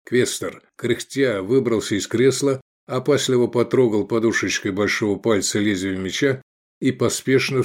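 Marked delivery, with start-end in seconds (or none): none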